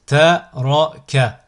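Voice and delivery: slow, each syllable drawn out separately; split into syllables. Shown as a demonstration. Teacher models a word for learners to repeat, split into syllables. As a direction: cut the word up, pausing between syllables. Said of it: "décomposer le mot".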